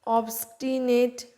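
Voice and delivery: অবস্টিনেট said slowly, each syllable clear; teacher showing how to say অবস্টিনেট; careful pronunciation. Shown as a demonstration. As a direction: model অবস্টিনেট slowly for students. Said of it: "অবস্টিনেট ওয়ার্ডটা বলবো".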